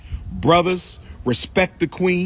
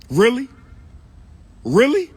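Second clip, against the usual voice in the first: incredulous really; 6 semitones higher, 4 dB louder than usual. Real, not acted. Incredulous at the cheesecake complaint.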